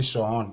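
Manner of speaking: shun pulled apart as sho-hon; two syllables. Shown as a demonstration. Teacher warning the class, shun wrongly split as sho-hon. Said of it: "that would be two syllables".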